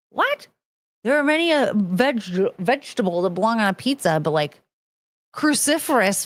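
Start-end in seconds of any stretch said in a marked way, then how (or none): 0.1-0.5 very high incredulous what; mock baffled what. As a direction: squeak it in disbelief. Baffled reaction.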